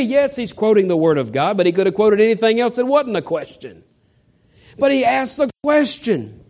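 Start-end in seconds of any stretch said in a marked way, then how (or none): none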